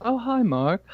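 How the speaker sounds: high, lilting movie-line imitation; movie catchphrase quote. Co-host echoing the quote from The Room, hammy impression. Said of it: "every once in a while go".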